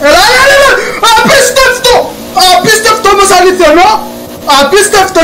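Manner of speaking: real laughing victory yell; euphoric shout. Real, not acted. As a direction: scream with joy at the win. Wins overtake, euphoric shouting.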